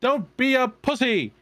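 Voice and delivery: high, loud shouted taunt; yelling at the screen. Acted. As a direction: egg him on like a heckler. Yelling advice at cartoon hero.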